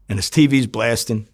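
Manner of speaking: ordinary talk, nothing marked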